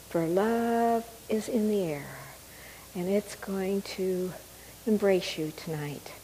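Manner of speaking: soft whisper close to the mic; right in your ear. Acted. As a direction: breathe the words into their ear. Intimate meditation whisper.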